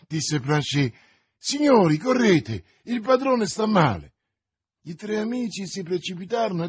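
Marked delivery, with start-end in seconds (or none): 1.4-4.1 high, loud cry for help; urgent call. Acted. Servant to his master's friends, urgent shout. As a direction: call the gentlemen running, alarmed.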